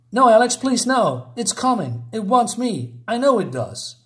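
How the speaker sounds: put-on a boy pleading not to be left; pleading child. Scotty begging Alex in fear.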